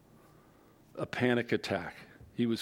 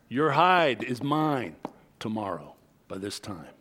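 mock threat; 3 dB louder than usual. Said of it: "issued this harrowing threat".

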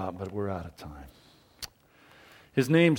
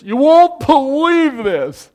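loud, high and slow with amazement; excited announcement; 13 semitones higher, 12 dB louder, slower than usual. Acted. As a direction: burst out with incredible news. Disciples excitedly tell absent Thomas.

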